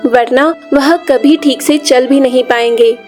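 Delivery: ordinary delivery, nothing marked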